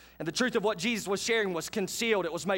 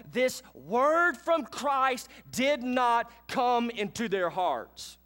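fervent preaching; 6 semitones higher, slower than usual. Acted. Preacher warning the congregation, slow and fervent. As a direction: press the warning on the church.

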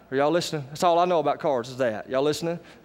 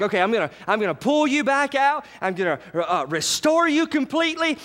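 God's voice; 10 semitones higher than usual. Retold or imitated. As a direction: lift it up like a promise. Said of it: "He's like".

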